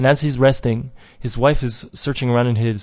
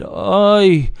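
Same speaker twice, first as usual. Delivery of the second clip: loud, high wail of regret; anguished cry; 7 semitones higher, 5 dB louder than usual. Acted. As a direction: cry out as if hitting your own head. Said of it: "he hits himself on the head".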